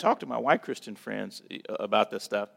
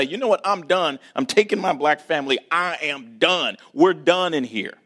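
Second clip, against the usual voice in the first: loud, defiant retold quitting speech, slowing at the end; angry walkout; fed-up declaration; 4 semitones higher, 6 dB louder than usual. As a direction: slam the door with words. Retold plan to quit angrily.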